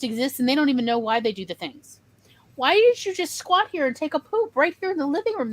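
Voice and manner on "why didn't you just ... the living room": higher mock-parent voice, baffled; exasperated parent questioning. Parent asking a child about a mess, mock-baffled. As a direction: ask it like a parent who can't believe the mess.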